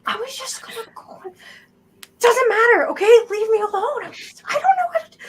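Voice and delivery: high-pitched, panicky brush-off; high whiny voice of a kid desperate to get away; flustered cornered kid. Acted. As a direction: squirm and protest in a high voice.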